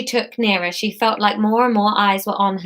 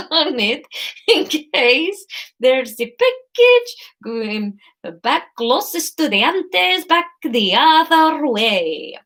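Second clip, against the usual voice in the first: put-on high, very slow Spanish accent; Jane the Virgin; 5 semitones higher, much slower than usual. Courier explaining a return label, badly faked Spanish accent. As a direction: drawl a hammed-up Spanish accent. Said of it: "I'm channeling my best Jane the Virgin".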